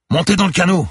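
high, forceful command; urgent loud yell; shouted order. A narrator's reading. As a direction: order her aboard over the waves. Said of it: "ordonna-t-il".

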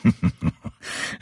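a breathy evil snicker; sneering snicker. A narrator's reading. Masked killer snickers at his prisoner, breathy snicker. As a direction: snicker at your captive. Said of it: "il ricana".

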